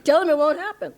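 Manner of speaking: high, loud, laughing retort; amused defiance. Retold or imitated. Teacher relishing Babaji's answer to the astrologer, laughing and loud. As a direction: throw it out loudly through laughter.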